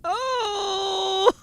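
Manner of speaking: sudden very high, loud scream of fright; terrified shout. Real, not acted. Streamer jump-scared in the submarine, terrified scream.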